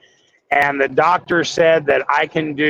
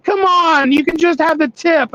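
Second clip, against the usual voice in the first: tempting cookies; 12 semitones higher than usual. Retold or imitated. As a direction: beg him to take just a little bite. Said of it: "they'll start hollering".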